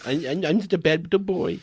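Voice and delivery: lightly put-on naughty boy voice, quick; mock brat voice. Mocking the trolly king as a smug little brat.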